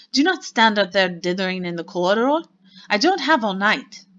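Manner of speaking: loud, impatient scolding; snapping. A narrator's reading. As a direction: bark orders at dawdlers, pitch swinging up. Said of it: "snapped the Grand High Witch".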